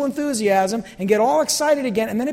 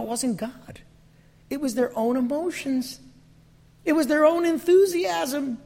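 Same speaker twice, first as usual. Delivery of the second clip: ordinary talk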